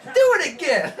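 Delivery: high, loud squeal of a demand; imitated excited lady. Lady fan to the Rock impressionist, high eager mimicry. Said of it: "she's like".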